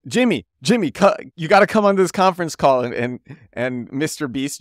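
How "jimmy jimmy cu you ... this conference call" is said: urgent, high and loud imitation; Gary Vaynerchuk. Caller begs Jimmy onto a conference call, excited urging. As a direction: pester him excitedly, pitch jumping up. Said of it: "Gary's like".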